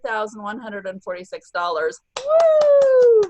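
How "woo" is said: high, loud cheering whoop; excited whoop. Real, not acted. Host cheering the top seller on the call, high excited whoop. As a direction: cheer her on like she's in the room.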